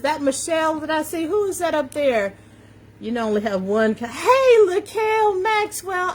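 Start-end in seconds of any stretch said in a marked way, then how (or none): 4.1-6.1 high, stretched-out hello; excited greeting. Real, not acted. Host spotting a friend on the live stream, excited greeting. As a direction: sing out the name in delight.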